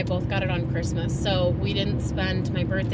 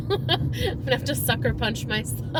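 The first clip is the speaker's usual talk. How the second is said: teary laugh; 3 semitones higher than usual. Real, not acted. Laughing through tears.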